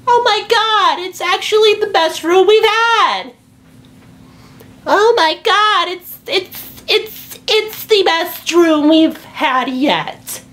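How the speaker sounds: a mom melting with delight; squealing drawn-out wonder; amazed mom. Retold or imitated. Mom finally sees room.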